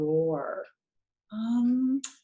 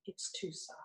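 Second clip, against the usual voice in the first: whispered even softer; 4 semitones lower, 16 dB quieter than usual. Shown as a demonstration. Teacher showing a whisper is not a bellow, whispered. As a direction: hush it right down. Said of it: "A whispering voice would not be a bellow".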